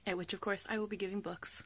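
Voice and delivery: whispered joke. Real, not acted. Whispered joke about giving books.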